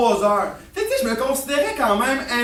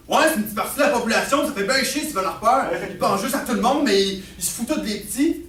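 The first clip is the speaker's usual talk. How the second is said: quick acted father rant; fast, worked-up comeback; irritated retort; faster than usual. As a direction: throw it back at full voice. Father throws argument back, ranting.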